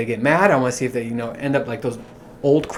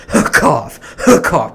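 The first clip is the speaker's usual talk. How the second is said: loud spoken-out "cough" burst; demonstrated cough; 6 dB louder than usual. Shown as a demonstration. Showing listeners how he coughs, loud mock cough. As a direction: cough the word out hard. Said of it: "'cause that's how I cough".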